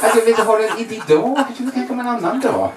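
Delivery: mock woman voice. Voicing an egg-freezing woman.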